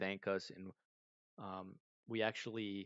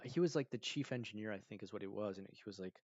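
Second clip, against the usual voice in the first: ordinary delivery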